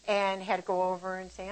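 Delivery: usual speaking voice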